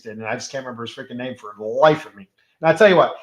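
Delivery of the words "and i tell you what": burping mid-sentence. Real, not acted. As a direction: talk through a burp. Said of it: "I'm burping here while I'm talking".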